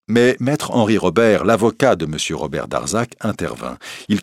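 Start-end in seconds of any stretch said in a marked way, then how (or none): none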